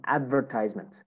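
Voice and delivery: American "advertisement", "tise" rhyming with "size"; American accent. Shown as a demonstration. Teacher models American "advertisement" for students, long I in "tise". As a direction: say "advertisement" as American "AD-ver-tize-ment". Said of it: "American accent".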